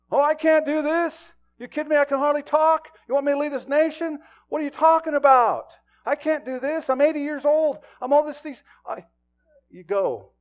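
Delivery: high, loud, panicky objection; protesting Moses. Acted. Moses begging God not to send him, high and loud.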